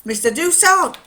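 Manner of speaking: high, loud trial run at Geordie; Geordie accent. Retold or imitated. Testing how the miner would say it, Geordie accent. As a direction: call the name out in Geordie. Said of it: "he'd say it in Geordie".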